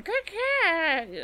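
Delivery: high, drawn-out mock crow call; imitated crow caws. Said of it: "a bunch of crows going".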